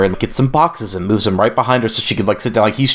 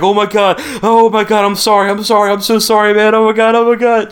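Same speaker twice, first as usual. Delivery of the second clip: much higher, hammed-up frantic apology; high-pitched mock panic, repeated pleading; panicked backpedaling; 11 semitones higher than usual. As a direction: imitate someone instantly horrified and begging forgiveness. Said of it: "immediately backpedaling on that".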